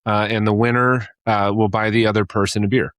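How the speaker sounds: ordinary delivery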